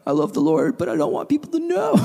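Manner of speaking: high, squirming confession; hammed-up awkwardness in a high voice; awkward reluctance. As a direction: squeak out an awkward admission, pitch jumping up at the end. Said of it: "it's, like, heavy silence".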